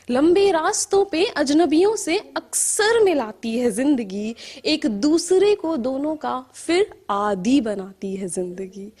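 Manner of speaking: measured verse with rising and falling cadence; poetry recital. Acted. Poet reciting to an appreciative audience, measured poetry recital. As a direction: recite in lilting lines, letting line endings sink.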